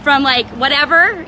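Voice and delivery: ordinary talk, nothing marked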